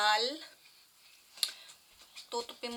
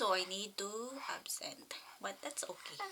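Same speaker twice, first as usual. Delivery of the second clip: whispered aside; 3 semitones lower, 11 dB quieter than usual. Real, not acted. Aside about skipping work.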